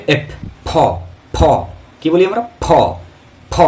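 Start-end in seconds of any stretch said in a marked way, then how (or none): 0.1-1.4 ফ as a puffed "pho" with lips closed; common wrong pronunciation. Shown as a demonstration. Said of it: "আমরা কি বলি?". 2.6-3.5 ফ as aspirated "pho"; common wrong pronunciation. Shown as a demonstration. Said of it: "কিন্তু এরকম নয়".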